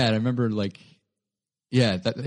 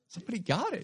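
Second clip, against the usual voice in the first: thrilled; 11 semitones higher, 7 dB quieter, faster than usual. Retold or imitated. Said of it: "I was like".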